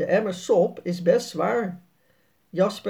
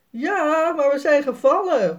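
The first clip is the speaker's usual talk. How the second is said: put-on higher, louder boy's call; raised call, a touch upset; 8 semitones higher, 5 dB louder than usual. A narrator's reading. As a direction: shout it back to dad. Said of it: "roept Jasper".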